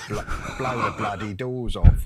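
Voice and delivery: loud London tough guy; Cockney accent. Retold or imitated. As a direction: bark it like a Cockney crook. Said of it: "I said".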